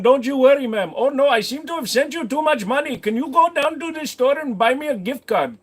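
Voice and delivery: high Indian accent, fake reassuring; scammer accent. Scammer coaxes a victim into buying gift cards, Indian accent. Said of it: "stop doing that accent".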